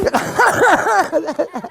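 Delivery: high burst of laughter; real amused laugh. Real, not acted. Host tickled by the volunteer's owl call, laughing.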